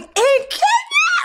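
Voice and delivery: disbelieving high cry of In Kenya; stunned high-pitched question; surprised. Retold or imitated. Shocked reaction retold.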